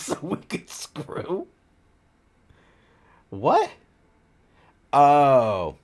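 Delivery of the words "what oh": usual speaking voice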